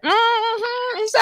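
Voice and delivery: stand-up character's sassy "mm-hmm". Retold or imitated. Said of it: "that character that I used to do in my act".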